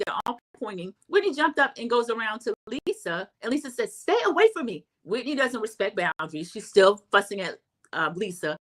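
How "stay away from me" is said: high-pitched imitated stay-back; mock warning. Vlogger mimics Lisa warning Whitney off, high. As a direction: push her away with your voice.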